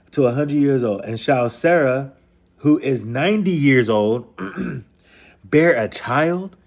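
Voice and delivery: slow, deliberate reading of the verse; slow reverent verse reading; scripture reading. Acted. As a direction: read the Bible line slowly and carefully. Reads the verse aloud.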